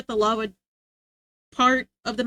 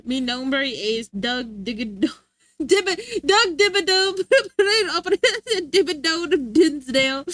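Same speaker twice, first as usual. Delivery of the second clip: slow, hammed-up Spanish name, laughing; playful fake Spanish introduction; mock Spanish intro; 3 semitones higher, slower than usual. Mock Spanish rich-man intro.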